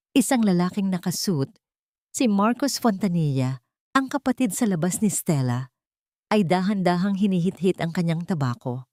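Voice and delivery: usual speaking voice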